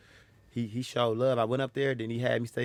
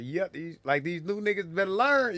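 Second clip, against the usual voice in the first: higher-pitched knowing warning; pitched-up impression of a grinning veteran; Jig; 7 semitones higher than usual. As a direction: imitate an older comic sizing up newcomers. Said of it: "You know how Jig talk".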